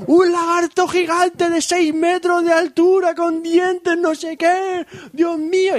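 high, slow panicked babble; terrified soldier. Retold or imitated. Soldier back at camp describing the giant lizard, high and panicked.

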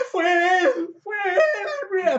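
high, loud wailing accusation, imitated; mock broken-voiced crying. Woman accuses neighbor to police, mock tearful broken shriek. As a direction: wail it as if in tears, hammed-up. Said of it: "con la voz rota".

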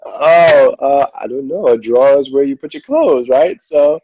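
talking through laughter; laughing. Real, not acted. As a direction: answer while still laughing. Laughing while answering accent question.

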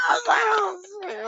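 high squealed laugh while retelling a scare; mock-scared yelp breaking into laughter; laughing fright. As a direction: crack up replaying your own panic. Laughing as he retells being spooked.

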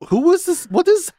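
high-pitched, incredulous questioning; bewildered reaction. Retold or imitated. Podcaster recalling confusion at an odd character, high baffled questioning. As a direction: pitch leaping up in mock bewilderment.

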